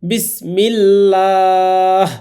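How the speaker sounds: বিসমিল্লাহ slow with a light, thin lam, লা as a; thin lam in Bismillah. Shown as a demonstration. Teacher demonstrates the thin lam after zer. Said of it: "লামটাকে পাতলা করে পড়তে হবে".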